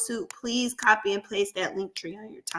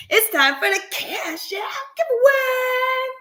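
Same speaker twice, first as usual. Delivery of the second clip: higher, loud and slow, game-show announcement; announcer; 14 semitones higher, 4 dB louder than usual. Acted. Announcing the giveaway again.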